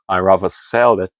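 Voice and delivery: normal talking voice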